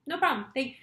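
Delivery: breezy fake-casual. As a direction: toss it off brightly as if nothing matters. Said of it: "another version of the same story".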